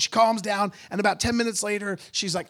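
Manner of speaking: usual speaking voice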